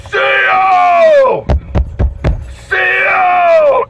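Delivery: high, loud yell for the guard; imitated prisoner's piercing holler; screaming inmate. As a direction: shout it out across a silent room. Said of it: "this guy is screaming".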